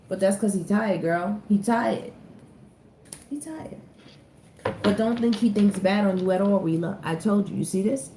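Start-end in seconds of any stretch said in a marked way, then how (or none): 3.3-3.8 soft. Real, not acted. Sympathetic repeat about Blue.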